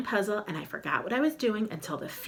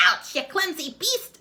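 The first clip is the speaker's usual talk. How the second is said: high, loud cracked screech; screeching witch; 12 semitones higher, 5 dB louder than usual. Acted. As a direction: screech at the clumsy intruder. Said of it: "a cracked voice screeched".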